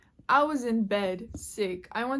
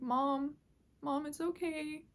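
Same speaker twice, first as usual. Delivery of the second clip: soft, higher soothing voice; gently reassure, imitating a child calming a parent; comforting; 4 semitones higher, 7 dB quieter, slower than usual. Injured kid to mom in the car, comforting. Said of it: "I was comforting her".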